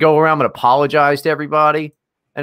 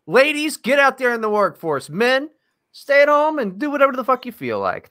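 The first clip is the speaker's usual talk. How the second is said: mock proclamation; 10 semitones higher than usual.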